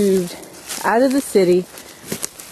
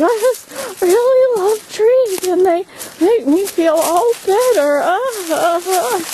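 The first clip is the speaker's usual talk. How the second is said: much higher, slower, put-on swooning voice; silly gushing tree lover; 12 semitones higher than usual. Making fun of herself as a tree hugger, high mock gush. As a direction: ham up a teary gush of love for trees. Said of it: "I'm being silly and making fun of myself".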